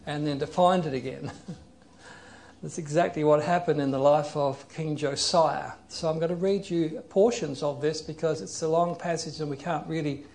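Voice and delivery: usual speaking voice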